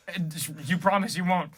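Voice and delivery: normal talking voice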